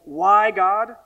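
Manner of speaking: loud, pleading Why to God; voicing a sufferer crying to God; anguished cry. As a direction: cry it out at heaven.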